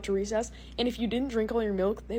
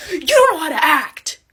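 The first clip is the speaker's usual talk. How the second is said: loud, higher snap at her; loud retort; 6 semitones higher, 12 dB louder than usual. Retold or imitated. The guy from the cafeteria snapping at her, loud. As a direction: bark it across the hallway. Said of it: "He's like".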